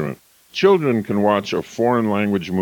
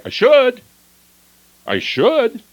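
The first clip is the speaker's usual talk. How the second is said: much higher, bright, louder; mock upbeat should; 5 dB louder, slower than usual. Shown as a demonstration. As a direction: say should like it excites you. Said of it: "You'll never hear anyone say".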